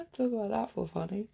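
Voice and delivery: ordinary talk, nothing marked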